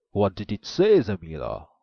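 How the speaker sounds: loud booming ogre question. A narrator's reading. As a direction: boom it like a giant. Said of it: "asked the ogre".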